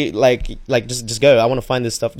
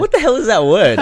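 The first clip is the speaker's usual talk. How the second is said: surprised; 9 semitones higher, 6 dB louder than usual. Real, not acted. Baffled by the word nomad.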